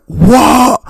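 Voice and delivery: retold high-pitched shocked yelp; hammed-up shriek. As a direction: squeal it in mock disbelief. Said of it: "I was like".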